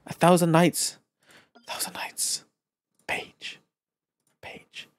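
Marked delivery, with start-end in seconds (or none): 1.6-2.4 whispered A thousand nights. Shown as a demonstration. Gamer whispers the page title to himself. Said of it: "repeats title whispered". 3.1-4.9 whispered Page. Real, not acted. Gamer whispers the found item's name.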